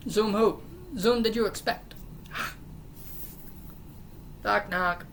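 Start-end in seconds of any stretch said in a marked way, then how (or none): 2.0-3.7 gasp. Acted. Loud gasp, no words.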